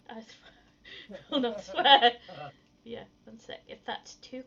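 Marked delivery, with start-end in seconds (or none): 0.1-1.2 very soft, voiceless whisper; barely audible whispered aside; hushed mutter. Real, not acted. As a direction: whisper it under your breath. Muttered reaction to swearing.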